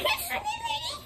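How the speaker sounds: very high, squealed silly noise; imitating Fred. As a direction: mimic a sped-up squeaky shriek. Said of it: "that did the weird".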